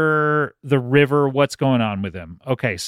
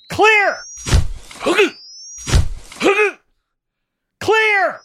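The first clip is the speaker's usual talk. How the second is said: shouting clear. Pretend. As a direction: yell it like a medic shocking. Pretend defibrillator during mock CPR.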